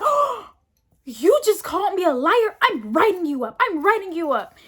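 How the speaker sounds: high, loud mock outrage; scandalised teacher. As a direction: imitate an offended teacher threatening a write-up. Teacher's shocked reaction, imitated.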